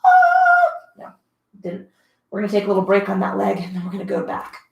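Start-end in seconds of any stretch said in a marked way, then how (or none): 0.0-0.7 loud high yelp of protest; mock pained squeal, much higher and louder; leg's protest cry. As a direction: voice your leg screaming no. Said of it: "and my leg said".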